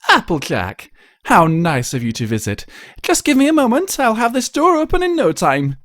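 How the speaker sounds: refined unicorn greeting a friend; delighted Rarity. Acted. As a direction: welcome her with flourish.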